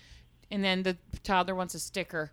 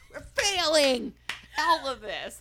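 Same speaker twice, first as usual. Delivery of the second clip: mock despair; 7 semitones higher, 3 dB louder, slower than usual.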